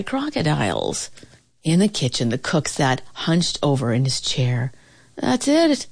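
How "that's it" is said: high, plaintive sigh of defeat; sad. A narrator's reading. Cook to his dog, fearing he is finished, sad. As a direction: give up in a higher, mournful voice. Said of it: "he said sadly".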